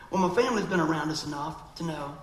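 ordinary talk

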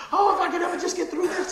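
playful exaggerated cry for rescue; mock pleading. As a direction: ham up a desperate believer.

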